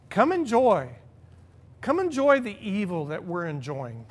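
ordinary talk

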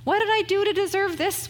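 high, plaintive voice of a worried sick person; self-pitying lament. Retold or imitated. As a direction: wail it like a hurt complaint. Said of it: "we say".

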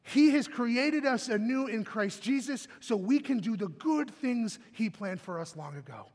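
usual speaking voice

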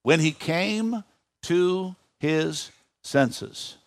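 very slow, word by word; measured reading with long pauses between words; slow, deliberate emphasis. Real, not acted. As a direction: space out each word so listeners can underline it. Repeats the underlined phrase for weight.

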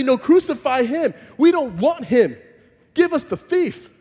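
mocking crowd cry. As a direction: throw it out like the mob rejecting him. Crowd rejects Jesus, wants thief.

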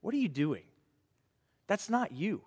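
soft, hushed rebuke from a caring friend; quiet friend's rebuke. Retold or imitated. Friend calling Matt out, quiet gentle rebuke. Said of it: "My little redhead friend Matt saying".

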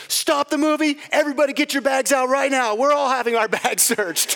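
high, loud commanding imitation; retold indignant shout. Imitating mother-in-law standing up in the cinema, indignant shouting. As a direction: act out taking charge of the whole room.

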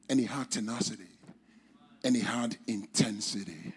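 ordinary talk

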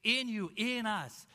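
slow, with "in" hit each time; "in" emphasized on both phrases; slow deliberate stress on "in". Shown as a demonstration. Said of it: "the context of those three words".

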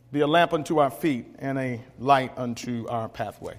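quiet, sincere closing words; solemn invocation. Acted.

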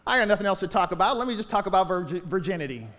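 fast, throwaway mock voice; mock rushed aside. Preacher mocking Paul's supposed motive, fast and offhand. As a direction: rattle it off like a careless excuse.

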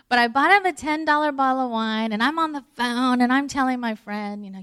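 normal talking voice

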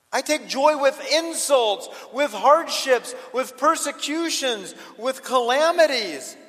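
loud, high, slow build through the list; excited joy. Acted. Apostle taking joy in insults and calamities, excited. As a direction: hammer each hardship with exultant relish. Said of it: "I get real excited about this".

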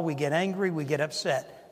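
ordinary talk

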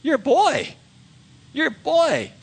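high, mocking giant's sneer; Goliath jeering at the young shepherd; scornful giant. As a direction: scoff down at the boy. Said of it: "he looks at him and he says".

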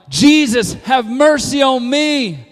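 loud, high, drawn-out plea; emphatic cry. Shown as a demonstration. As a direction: shout it slowly, desperate to be heard. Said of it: "in the emphatic tone".

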